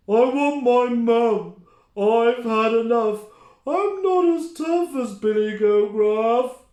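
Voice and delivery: high, loud wailing troll; whiny troll. Acted. The soaked troll gives up, high loud wail. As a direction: wail it like a big baby.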